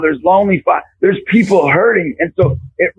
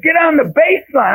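raised-pitch coach's order; Coach Carter; 9 semitones higher than usual. Retold or imitated. New coach orders kids at first practice, imitating a movie coach. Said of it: "trying to be like Coach Carter".

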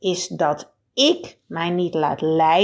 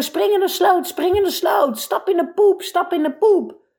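high-pitched imitation of a voice yelling in your ear; mock nagging yell; 9 semitones higher than usual. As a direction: nag it like a pest bossing you. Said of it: "iemand in je oor loopt te schreeuwen". (hoog, spottend nageschreeuwd als een zeurend stemmetje)

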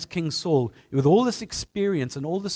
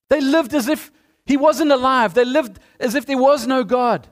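rising, louder sermon that hammers the point home; preaching at full force; 9 semitones higher, 8 dB louder than usual. Acted. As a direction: preach it higher and louder, slowing hard on "acting like atheists".

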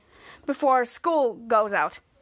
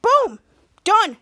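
loud, high, punchy mock triumph; triumphant boom; 8 semitones higher, 7 dB louder than usual. Henry finishing on laptop, loud high triumph. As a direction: slam it down like a win. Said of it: "And then Henry's like".

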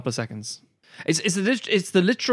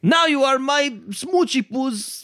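high, slow hammed-up Eastern European accent; mock Eastern European accent; 11 semitones higher, 4 dB louder, slower than usual. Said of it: "in typical European way".

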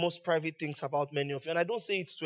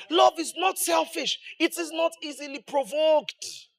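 preaching shout; 8 semitones higher than usual. Real, not acted. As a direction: proclaim it to the hall. Preaching at full force.

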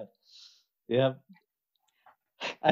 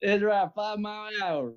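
nod along like a country salesman; 9 semitones higher than usual. Acted. Replies in redneck role.

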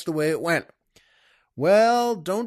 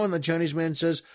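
ordinary talk, nothing marked

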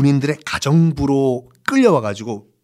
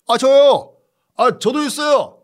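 eager soldier; 11 semitones higher than usual. Acted.

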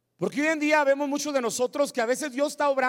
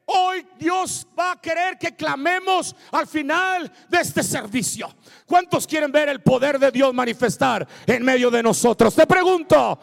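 loud, rising exhortation; full-force preaching; 4 semitones higher, 9 dB louder than usual. Real, not acted. Preacher urges church to cry out, full-force preaching. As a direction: fire up the congregation.

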